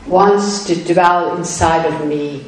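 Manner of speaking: normal talking voice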